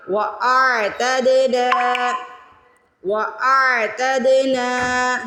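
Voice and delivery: وَأَعْتَدْنَا with a bouncing qalqala on د and a long نا; qalqala on dal. Shown as a demonstration. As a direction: recite وَأَعْتَدْنَا slowly, echo on the dal. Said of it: "دال پہ قلقلہ کریں گے". (وَأَعْتَدْنَا میں دال پر قلقلہ اور نون کھینچ کر)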